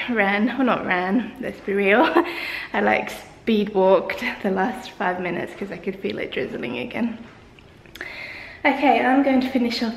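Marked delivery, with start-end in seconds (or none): none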